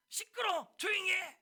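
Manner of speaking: imitated angry silencing; scolding shout. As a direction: shout him down as the crowd would. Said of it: "사람들은 그를 꾸짖는 거예요".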